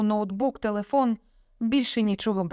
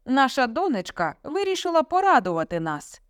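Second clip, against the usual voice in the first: higher-pitched mock delight; sarcastic; 6 semitones higher, 3 dB louder than usual. A narrator's reading. Father telling mother about daughter, sarcastically. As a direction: announce it with heavy irony. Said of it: "саркастично промовив Степан Михайлович".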